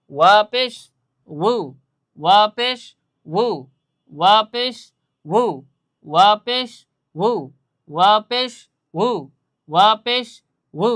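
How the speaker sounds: loud chanted repetition of ওয়া পেশ উ; drilling waw pesh. Shown as a demonstration. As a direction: call out each ওয়া পেশ, then a short উ. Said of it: "এভাবে বারবার আপনারা পড়তে থাকবেন".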